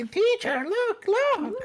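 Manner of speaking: high-pitched mimicked child; kid calling teacher. Man imagines kid showing dead mouse, mock child voice. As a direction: put on a high, insistent child voice. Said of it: "a kid just walked up to me".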